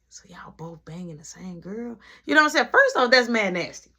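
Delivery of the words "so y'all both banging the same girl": hushed, scandalized whisper. Retold or imitated. Whispering to her friend about the scandal. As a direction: breathe it like gossip. Asking something scandalous in secret.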